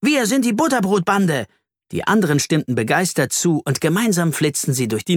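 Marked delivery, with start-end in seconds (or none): 0.0-1.5 bright high gang cry; cheerful shout. A narrator's reading. Ronny proudly names their gang, high and cheerful. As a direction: call it out gleefully. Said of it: "Ronny rief fröhlich".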